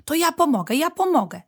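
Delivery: high, loud, excited little badger; bouncy high-pitched child volunteering; eager child. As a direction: pipe up eagerly to offer help. Excited little badger hopping, offers help.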